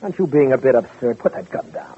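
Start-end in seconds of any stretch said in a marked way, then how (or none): 1.2-2.0 hushed, urgent whisper at the gun; tense whisper. Acted. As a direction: whisper it tightly, don't set her off. Man threatened with a gun.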